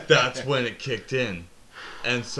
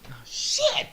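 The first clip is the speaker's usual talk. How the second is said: much higher sudden yelp; surprised outburst. Real, not acted. Realising the nickname joke mid-story, high surprised yelp. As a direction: blurt it out caught off guard.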